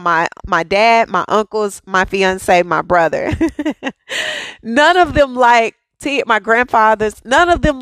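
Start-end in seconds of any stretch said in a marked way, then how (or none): 3.4-4.6 laugh. Real, not acted. Laughing about the men.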